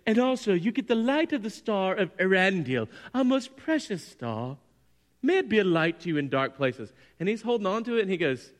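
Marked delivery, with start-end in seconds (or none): 0.1-6.9 grand elf queen presenting a treasure; Galadriel. Retold or imitated. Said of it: "she's like".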